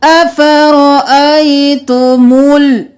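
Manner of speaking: slow, chanted tajweed recitation; Quranic recitation. Shown as a demonstration. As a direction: chant it slowly, stretching the vowels. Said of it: "एक अलिफ के बराबर खींचेंगे".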